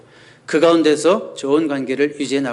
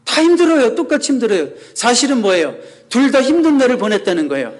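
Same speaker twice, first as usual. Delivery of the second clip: emphatic; 8 semitones higher, 4 dB louder than usual. Real, not acted. Preacher stresses his point to congregation.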